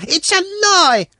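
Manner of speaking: high, slow outraged cry; boy jumps out crying foul. A narrator's reading. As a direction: protest loud and high. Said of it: "Sanka cried".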